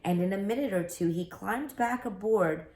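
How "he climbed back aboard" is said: usual speaking voice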